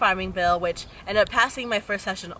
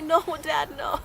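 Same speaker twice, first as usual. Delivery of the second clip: high-pitched mock protest; laughingly retold objection; exasperated refusal; 8 semitones higher than usual. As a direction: act out refusing an embarrassing dad. Her refusal in the retold quarrel.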